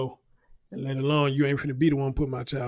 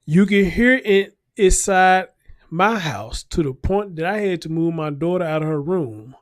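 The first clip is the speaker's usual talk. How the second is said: reading the report aloud, louder and higher; a dramatic news read, raised and slowed; 3 semitones higher, 6 dB louder than usual. Acted.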